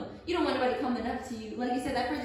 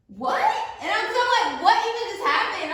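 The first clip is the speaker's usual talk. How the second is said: higher and louder, stunned confusion; shocked disbelief; 10 semitones higher, 9 dB louder than usual. Retold or imitated. She reacts to a stranger accosting her, shocked and loud. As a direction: blurt it out, completely thrown.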